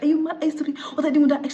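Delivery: high-pitched mock scolding; angry berating. Groom berating his bride over the ex, angry berating.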